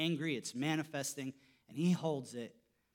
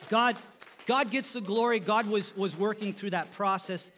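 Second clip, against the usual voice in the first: lifted high and loud, God gets the glory; loud preaching praise to God; loud proclamation; 6 semitones higher, 9 dB louder than usual. Real, not acted. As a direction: proclaim it to the whole church.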